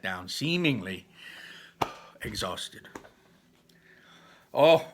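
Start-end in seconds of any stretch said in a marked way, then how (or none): 4.5-4.9 amused teasing. A narrator's reading. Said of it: "laughs said Barley".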